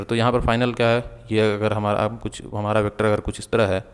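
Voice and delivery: usual speaking voice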